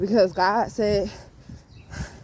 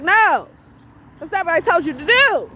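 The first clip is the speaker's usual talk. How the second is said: higher, loud scolding mother; loud exasperated mama scolding a child; 9 semitones higher, 5 dB louder than usual. Retold or imitated. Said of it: "using my mama voice".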